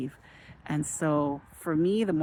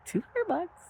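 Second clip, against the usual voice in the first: much higher, stunned question; can't believe the number; astonished. Retold or imitated. Watching her slot total climb, high stunned disbelief.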